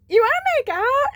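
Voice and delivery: high, loud squeal; giddy proposition. Retold or imitated. Friend in the cab laughing till crying, giddy.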